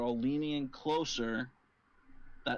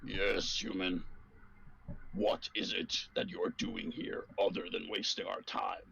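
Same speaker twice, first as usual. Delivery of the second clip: low, gruff answer from a menacing figure; much gruffer, lower voice; 4 semitones lower than usual. Acted. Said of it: "You hear a much gruffer voice answer".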